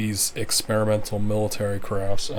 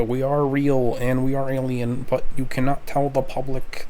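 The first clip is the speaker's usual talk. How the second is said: stilted alien voice, slightly raised; alien impression; 4 semitones higher, 3 dB louder than usual. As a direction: put on a measured alien voice. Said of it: "this is my alien impression".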